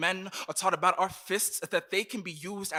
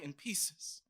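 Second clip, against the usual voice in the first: quiet; 8 dB quieter than usual. Acted. Quiet closing lines.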